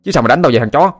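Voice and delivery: furious shout daring his friend to fight; red-faced anger. A narrator's reading. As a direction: spit the challenge back. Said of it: "Cầm đỏ mặt tía tai".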